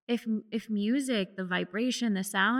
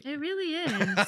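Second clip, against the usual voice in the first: laughing; 8 semitones higher than usual. Real, not acted. Agreeing warmly with cohost.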